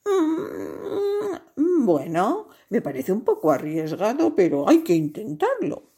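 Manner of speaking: long high pondering hum, then swooping speech; hesitant agreement. A narrator's reading. Giraffe judging a risky plan, hesitant but willing. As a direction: mull it over, then commit.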